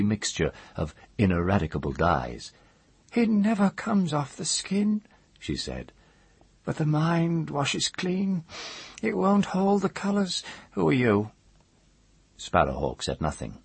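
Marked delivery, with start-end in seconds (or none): 3.1-5.0 very high, thin woman's voice; high-pitched, talking of her stains; dyer woman. A narrator's reading. Dyer woman to the mage about her stained arms, high-pitched. 6.6-11.3 dyer woman. A narrator's reading.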